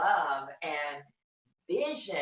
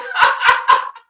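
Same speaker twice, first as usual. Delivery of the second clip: a loud, high, breathy burst of laughter; 18 dB louder than usual. Real, not acted. Laughing after the comeback to the Harvard classmate. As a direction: crack up hard at your own punchline.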